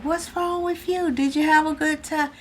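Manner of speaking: high concerned question; imitating herself. Mother asking her upset son, high voice. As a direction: lift the voice, asking gently.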